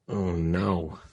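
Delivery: ordinary talk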